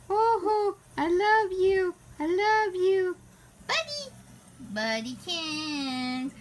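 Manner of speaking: fond cooing pet talk. Real, not acted. Baby talk to her cat.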